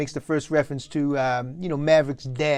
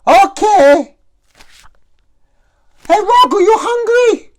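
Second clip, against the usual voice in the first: much higher, louder mother calling out; calling old mother; 13 dB louder than usual. Acted. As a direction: shout sweetly from the kitchen like an old mom. Mom calling out to son.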